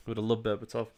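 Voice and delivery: softly, to himself; quiet aside. Real, not acted. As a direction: murmur it half under breath.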